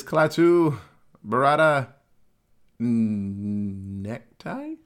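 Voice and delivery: slow, careful syllables, then a stumble into "Necktie"; fumbled magic words. Retold or imitated. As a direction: sound out "Klaatu, Barada" confidently, then guess "Necktie" when the last word escapes. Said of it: "I know it was an N-word".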